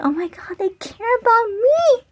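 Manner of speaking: high, half-crying mock emotion; touched. Moved by the bodyguard's care, mock tearful cry. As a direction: voice cracking as if moved to tears. Retold overwhelmed feeling.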